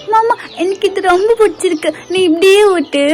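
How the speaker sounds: put-on high playful little-child voice; a kid enjoying the game; lightly high child voice; happy child. Child Dae playing.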